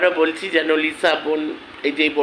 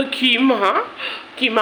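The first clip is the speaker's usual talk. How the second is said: higher-pitched, laughing scoff; scornful laugh; 6 semitones higher, slower than usual. Real, not acted. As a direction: laugh derisively into the name. Scornful laugh at being condemned.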